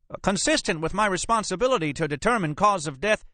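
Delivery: very high-pitched, flustered justification; defensive bluster. Acted. Examiner defends himself to agent.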